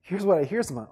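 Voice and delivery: normal talking voice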